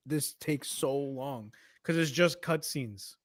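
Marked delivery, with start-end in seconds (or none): 0.1-1.5 weary impatience. Retold or imitated. Acted boredom at long cutscenes.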